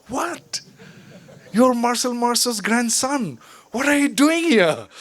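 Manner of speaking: high-pitched retold disbelief; astonished. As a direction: act out being stunned, voice jumping up. Acting out shock at the revelation.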